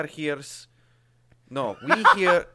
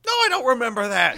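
mock indignant; 9 semitones higher, 7 dB louder, faster than usual.

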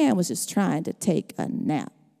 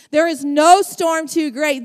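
ordinary delivery

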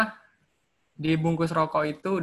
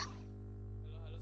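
faint, far-off hello; barely audible through the mic; 22 dB quieter, slower than usual. Real, not acted. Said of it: "Eh, masih kecil". (suara halo yang kecil)

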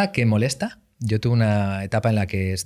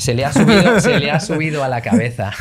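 mocking haters' jab, higher and louder; sneering; 6 semitones higher, 6 dB louder than usual. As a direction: sneer it like a resentful stranger. Voicing haters' complaint. (burlón, imitando a quien critica, más alto)

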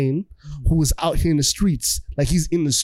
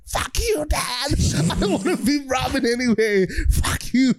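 high-pitched hammed-up tantrum; mock teenage defiance; 6 semitones higher than usual. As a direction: throw a squeaky mock fit at dad. Son defies disowning father.

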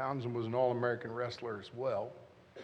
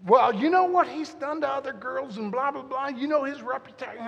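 much higher, louder retold protest; mock pleading; 10 semitones higher, 7 dB louder than usual. Husband retells arguing with girlfriend, high and pleading. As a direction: plead your case in a high voice. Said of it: "I'm like".

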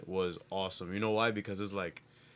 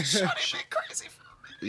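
whisper; 6 semitones higher than usual. Acted. Playful whispered aside.

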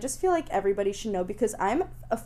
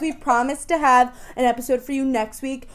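ordinary delivery

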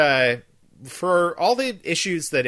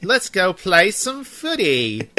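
slow, higher-pitched hammed-up British accent; mock British accent; 6 semitones higher, 4 dB louder, slower than usual. Shown as a demonstration. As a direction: draw it out in an exaggerated British accent. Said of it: "actually trying to do an accent".

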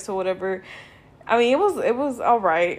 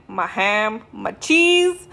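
usual speaking voice

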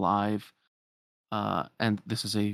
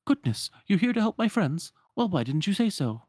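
higher, animated wardrobe voice; welcoming wardrobe; 9 semitones higher, 3 dB louder than usual. Acted. Wardrobe learning they came to help her friends, pleasantly surprised.

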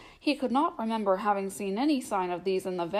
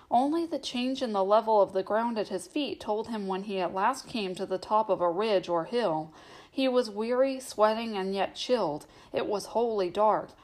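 ordinary delivery

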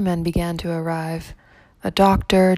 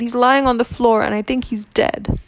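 higher, upset report to the police; distressed; 5 semitones higher, 3 dB louder than usual. A narrator's reading. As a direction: tell them in alarm. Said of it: "she cried".

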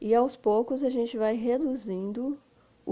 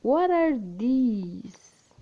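very slow, each word drawn out; slow model phrase; slow demo; much slower than usual. Shown as a demonstration. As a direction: spell out "What are these?" slowly for learners. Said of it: "você vai usar o these".

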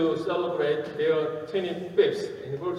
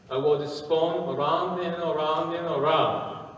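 normal talking voice

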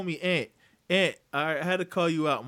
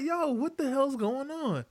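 slow, high-pitched disbelief; baffled reaction; 10 semitones higher, slower than usual. Retold or imitated. Acts out his baffled reaction.

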